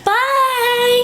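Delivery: high, bright sign-off; excited goodbye. Real, not acted. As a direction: sing out bye.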